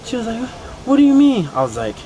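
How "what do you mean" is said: high, louder confused voice; imitating Tiffany. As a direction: play her high and loud. Voicing Tiffany in story.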